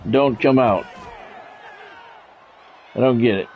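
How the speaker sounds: ordinary talk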